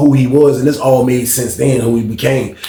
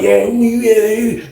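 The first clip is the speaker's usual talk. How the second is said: put-on high, drawn-out old head yeah; 9 semitones higher, much slower than usual. Fonz talks to dude, imitated as an old head. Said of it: "He looking like a real old head".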